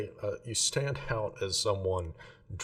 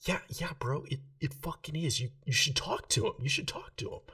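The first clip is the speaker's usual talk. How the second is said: put-on higher, half-whispered drunk bro egging his friend on; drunk loud whisper; 8 semitones higher than usual. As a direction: nudge your buddy in a tipsy stage whisper. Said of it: "this loud whisper voice".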